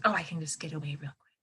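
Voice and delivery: fast, soft whisper of the little fish. Pretend. Teacher voicing the fish for the class in a quick whisper.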